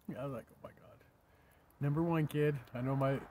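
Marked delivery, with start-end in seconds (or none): 0.5-1.0 breathless whispered aside; awed whisper. Real, not acted. Grandpa melting over granddaughter, whispered. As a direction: let it slip out under your breath.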